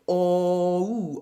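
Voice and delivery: "O-oo" gliding dark O into oo; o-oo diphthong. Shown as a demonstration. Coach models the diphthong in flows. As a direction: round from dark O to oo. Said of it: "We now have an O-oo sound".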